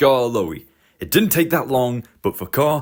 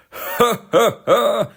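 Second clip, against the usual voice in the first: laugh; 10 semitones higher, slower than usual. Acted. Distant engine laughing.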